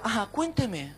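soft, understated clinician's prompt; attentive doctor. Retold or imitated. Doctor drawing out a depressed patient, quiet and attentive. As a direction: gently invite the patient to go on.

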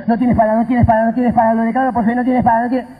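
rapid, repetitive denial; mock rote declaration. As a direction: rattle it off like a mindless mantra. Mocking rote faith declaration.

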